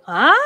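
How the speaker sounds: "huh?" sliding up almost an octave; near-octave rise. Shown as a demonstration. Lecturer attempting an octave slide for students: big rising "huh?". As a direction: stretch the "huh?" upward as far as you can. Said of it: "Or still more, the interval in octave".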